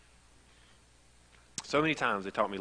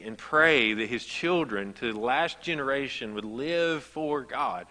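slow, weighty, louder reading; slow each phrase down like a vow; solemn, drawn-out preaching; 3 semitones higher, 4 dB louder, slower than usual. Acted.

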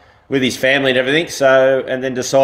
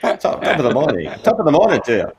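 higher-pitched Irish attempt; Irish accent; 5 semitones higher, faster than usual. Shown as a demonstration. Lifeguard answering a viewer's request, Irish accent. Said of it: "Can you guys do your best Irish accent".

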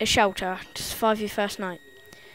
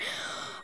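sharp gasp; 11 dB quieter than usual. Real, not acted. Sudden idea.

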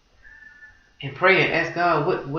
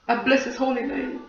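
praise; 8 semitones higher than usual. Real, not acted. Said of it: "And I just bless him".

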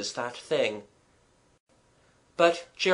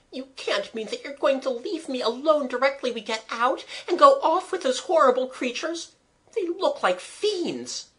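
put-on high-pitched, anxious girl's plea; frightened protest; 11 semitones higher than usual. A narrator's reading. Girl protesting being left alone with the horrible creatures, frightened. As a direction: protest in dismay, voice high throughout.

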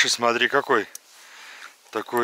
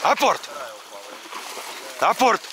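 dog command; 4 dB quieter than usual. Real, not acted.

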